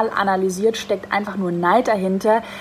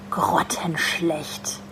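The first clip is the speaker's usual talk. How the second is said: slow, breathy whisper; 5 semitones lower, 5 dB quieter, much slower than usual. Retold or imitated. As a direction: whisper the insult slowly. Reading out hateful feedback. (geflüstert)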